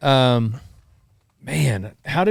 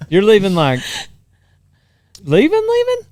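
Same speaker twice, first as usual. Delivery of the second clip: disbelief; 10 semitones higher, 7 dB louder than usual. Real, not acted. Incredulous repeat of leaving.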